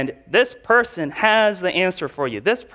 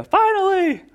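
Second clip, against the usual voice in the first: much higher, joyful cry of relief; relieved joy; 13 semitones higher than usual. Pretend. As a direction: exclaim it like a prayer finally answered. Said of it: "And you go".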